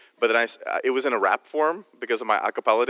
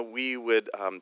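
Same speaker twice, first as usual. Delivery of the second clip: usual speaking voice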